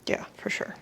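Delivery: whispered aside to herself; a quick whispered agreement. Real, not acted. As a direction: breathe it to herself, no voice. Aside while measuring flour.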